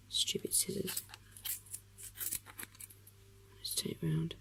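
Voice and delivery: annoyed whisper under the breath; whispered mutter. Real, not acted. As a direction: grumble at the scissors in a whisper. Muttering at the scissors mid-task.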